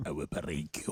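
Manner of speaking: deep, low Russian boxer voice; Drago voice. Retold or imitated. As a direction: growl it like Ivan Drago. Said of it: "we do Drago".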